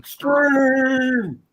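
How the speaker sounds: high, lightly hammed hype echo. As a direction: toss the word up like an ad tag. Echoing the hype word.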